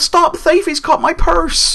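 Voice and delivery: very high, loud mock Cockney cry; hammed-up Cockney theft victim yelling; really Cockney. Imitating a robbed townsperson raising the alarm, shrill-pitched Cockney. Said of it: "either Cornish or really Cockney".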